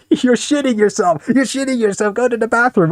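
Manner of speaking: high-pitched scolding; scolding guardian. Retold or imitated. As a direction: nag like a fed-up guardian. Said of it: "It was like".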